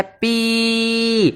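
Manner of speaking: पी held long and level, ending in a clear ई; stretched पी. Shown as a demonstration. Teacher drawing out पी for the class. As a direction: draw out पी so its long ई is heard. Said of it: "पी पे जहाँ जोर दो".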